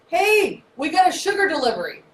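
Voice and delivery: high, loud, cheery announcing; high chirpy delivery call; insulin delivery guy. Acted. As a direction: call out like a courier at the door. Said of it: "knocks on the cell door and goes".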